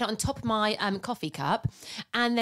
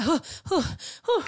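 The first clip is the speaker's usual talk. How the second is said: panicked breathing; 10 semitones higher than usual. Retold or imitated. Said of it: "She did sound a little bit panicked".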